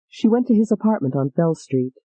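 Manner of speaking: usual speaking voice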